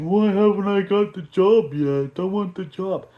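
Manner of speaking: fake-cry like a sulking coach; mock crying. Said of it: "the guy's crying too. He's like".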